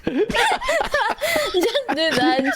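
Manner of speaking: high, very slow laughing plea. Real, not acted. Laughing, telling chat to copy the shot. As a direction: laugh the words out slowly.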